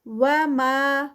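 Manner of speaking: slow recitation holding ما for two counts; Quranic recitation. Shown as a demonstration. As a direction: draw out ما for two beats. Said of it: "ما پہ ٹو counts". (ما کو دو حرکات کھینچ کر تلاوت)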